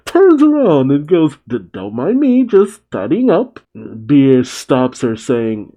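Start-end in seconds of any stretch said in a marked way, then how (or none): 1.5-3.6 stammered, flustered excuse rising in pitch; nervous stutter, going higher at the end; mock freak-out. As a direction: play caught red-handed, lightly panicked. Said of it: "She freaks out".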